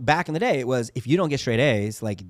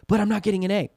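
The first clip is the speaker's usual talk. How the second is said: high, louder mock protest; protesting kid; 9 semitones higher, 5 dB louder, faster than usual. Son objects to dad about his grade, imitated high protest. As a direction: imitate a worried child objecting.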